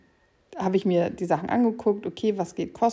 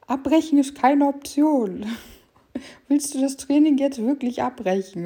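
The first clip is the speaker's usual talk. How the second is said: pushy app voice; 7 semitones higher, 4 dB louder than usual. Retold or imitated. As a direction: play the app scolding you. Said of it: "Dann fragt die App auch noch oder sagt".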